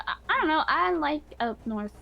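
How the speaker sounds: robotic, glitchy voice. Real, not acted. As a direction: voice sounding like a robot. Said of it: "why do I sound like a robot?".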